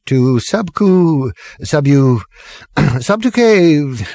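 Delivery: high, slow, tripping over the word; drunken stumble. Acted. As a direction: fumble the long word drunkenly.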